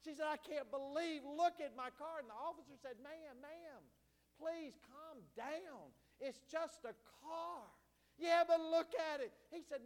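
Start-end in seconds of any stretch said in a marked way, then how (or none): 0.3-2.2 high, raised mock holler; retold screaming. Preacher imitating a woman ranting about her wrecked car, mock holler. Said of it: "she was screaming, hollering". 3.0-7.7 slow it down to soothe someone hysterical; imitated calming plea. Preacher voicing an officer to a frantic car owner, calming. 8.2-9.3 loud, high mock wail of protest; imitated hysterical protest. Preacher playing the car owner arguing with an officer, mock hollering.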